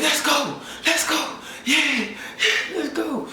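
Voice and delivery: very high, restrained celebratory scream; imitating a wildly hyped fan; mock screaming. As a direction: cheer in a squeaky, held-back scream. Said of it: "I'm just screaming like".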